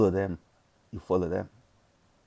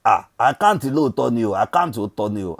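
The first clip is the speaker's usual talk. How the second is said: put-on heavy Nigerian accent, louder; 5 dB louder than usual. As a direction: slip into a thick Nigerian accent. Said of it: "I gotta put my Nigerian accent".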